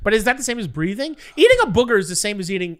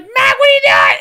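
much higher, louder shout of his name; startled yell at child; 12 dB louder than usual. Retold or imitated. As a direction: yell at the kid licking things, half alarmed. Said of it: "I was just yelling at the situation".

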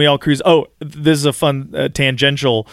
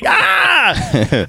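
imitated scream; 13 semitones higher than usual. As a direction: shout out like a fan losing his mind. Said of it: "He's, like, screaming".

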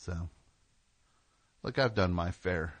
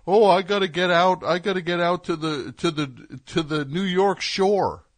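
higher, eager mock voice, slowing at the end; mocking Jersey folks; 7 semitones higher, 6 dB louder than usual. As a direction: lightly ham up a Jersey local desperate for New York. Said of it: "New Jersey people going".

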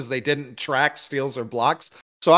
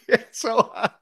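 laughing; 9 semitones higher, 5 dB louder than usual. Real, not acted. Laughing about the announcer voice.

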